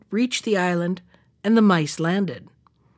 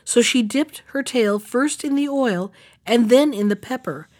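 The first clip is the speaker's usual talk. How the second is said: ordinary delivery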